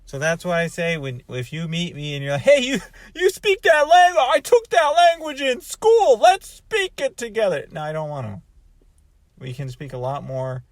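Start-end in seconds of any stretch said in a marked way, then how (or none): 2.4-7.6 much higher, louder eager voice; high mock-enthusiastic stranger voice; eager learner. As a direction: gush like a keen language student. Imitates eager classroom learner.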